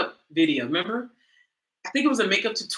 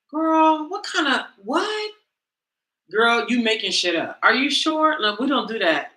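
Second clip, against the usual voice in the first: incredulous friend; 2 semitones higher than usual. Retold or imitated. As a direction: scoff at her like she's lying. Voicing skeptical church friend.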